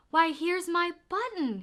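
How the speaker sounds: high, loud delighted cry; excited cry. A narrator's reading. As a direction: shout with joy at finding it. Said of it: "he cried".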